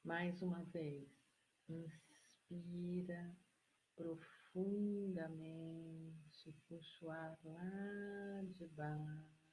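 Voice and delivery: very slow, soft, drawn-out instructions; guided breathing meditation. Real, not acted. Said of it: "Eu vou ter que mudar meu tom de voz". (meditação guiada, voz lenta e suave)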